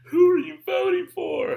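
high, loud whiny question; mock nosy asker. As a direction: ape the pushy person demanding your vote. Mimicking nosy voter again.

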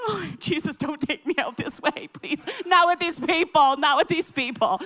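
hammed-up begging to heaven; desperate prayer. Wife praying among strangers in the bathroom, desperate.

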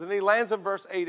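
ordinary talk, nothing marked

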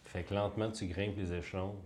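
soft, hushed scene setting; game master narration. Acted.

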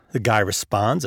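ordinary delivery

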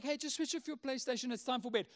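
imitating a mild, reasonable father; gentle, casual God. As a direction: say it lightly and softly, like a patient parent. God replays dad's line gently.

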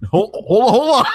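gasping through a laughing fit. Real, not acted.

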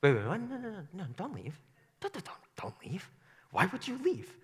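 high, soft, panicky pleading; pleading disciples. Acted. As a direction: stammer it out, begging him to stay. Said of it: "I think the disciples go".

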